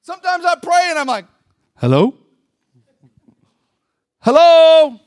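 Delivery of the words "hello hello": loud hello into the void; calling out. Acted. Praying man gets no feeling, calling out to God. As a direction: call out, louder and higher the second time.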